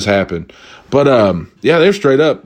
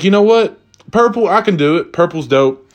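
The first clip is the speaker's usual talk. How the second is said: higher-pitched, retold enthusiasm; upbeat decision; 7 semitones higher than usual. Shopper talking himself into purple sheets, upbeat. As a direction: talk yourself into it brightly.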